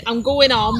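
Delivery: going home as "gooin om"; Derbyshire-style "I'm gooin om"; Derbyshire dropped letters. Shown as a demonstration. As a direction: drop the H of home, short "om". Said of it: "I grew up with saying".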